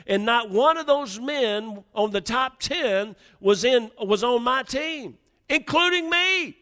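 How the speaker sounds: high, loud preacher's storytelling; impassioned preaching. Acted. Preacher retelling orders to a struggling married man, full force.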